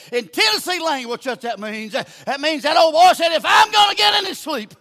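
loud, high preacher's holler; full-force preaching. Real, not acted. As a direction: build to a shouted punchline.

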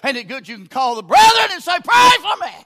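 loud, high shouted appeal; full-force preaching. Real, not acted. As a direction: cry it out to the congregation.

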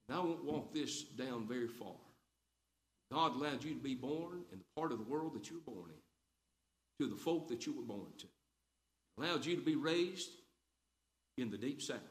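quiet heartfelt word to the congregation. Real, not acted. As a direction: lean in and speak softly to them. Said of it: "drops voice for tender aside".

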